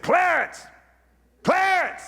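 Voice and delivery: high calling shout at the casket; preacher calling to the corpse; yelled name. Retold or imitated. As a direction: call out the dead man's name with authority. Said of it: "yelled at the corpse".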